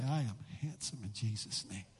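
very low, soft, playful self-assurance; hushed, deep mock-solemn declaration; mock mirror affirmation. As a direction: murmur it low to yourself as a joke.

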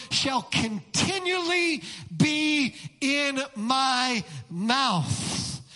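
slow, weighty emphasis from "continually" to "mouth"; emphatic stretched stress. Shown as a demonstration. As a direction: stretch "continually" and space out each word after it. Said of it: "here's the operative word".

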